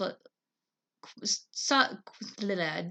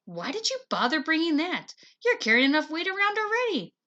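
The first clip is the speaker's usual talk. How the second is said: high, scoffing and louder; scornful sister; 7 semitones higher, 4 dB louder than usual. Acted. Sister scoffs at brother's heavy compass, high and louder. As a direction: sneer at his extra baggage.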